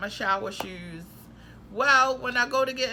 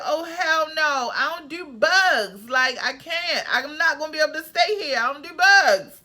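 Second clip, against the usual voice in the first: freaked-out refusal; 6 semitones higher, 7 dB louder than usual. Retold or imitated. Seeing a cockroach in the sink, loud horrified refusal. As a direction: blurt it out like you just saw a cockroach. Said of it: "I was like".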